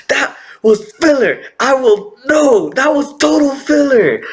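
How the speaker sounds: high-pitched, comic outrage; indignant protest. Retold or imitated. Viewer rejecting the recalled standstill, indignant protest. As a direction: object emphatically, voice pushed way up.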